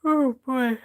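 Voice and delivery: yawning through the words. Real, not acted. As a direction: say it mid-yawn. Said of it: "Sorry, I'm yawning".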